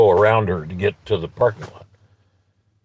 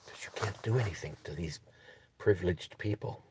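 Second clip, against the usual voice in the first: very soft, imitated hushed aside; hushed and secretive; confiding undertone; 3 semitones lower, 18 dB quieter than usual. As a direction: say it under your breath, as if not to be overheard. Retells worker's confidential answer.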